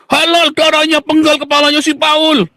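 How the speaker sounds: high-pitched parody of a death threat; mock outraged zealot. Opponents reply to his question, high mock outrage.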